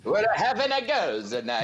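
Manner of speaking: high, slow Italian grandma voice; Italian grandma accent. Retold or imitated. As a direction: do an old Italian nonna. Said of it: "That's what she sounds like".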